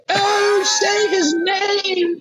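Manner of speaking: very high, slow falsetto queen; mock queen voice. Queen goading the losing prime minister, very high mocking voice. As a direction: draw it out high like a teasing old monarch. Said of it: "just going".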